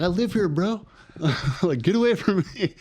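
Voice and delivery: high, exasperated retort, half laughing; mock indignant yell. Man kicked out telling security he lives there, high indignant yell. Retold clash with security guard.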